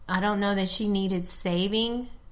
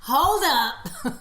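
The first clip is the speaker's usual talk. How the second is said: high, loud startled outburst; surprised laugh; 7 semitones higher, 4 dB louder, much slower than usual. Real, not acted. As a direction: caught off guard, laughing it off. Caught off guard by question.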